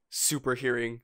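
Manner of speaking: ordinary talk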